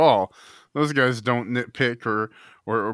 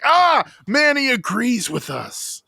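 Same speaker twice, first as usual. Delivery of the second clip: mock fan; 3 semitones higher than usual. Mock fan voice about Manny.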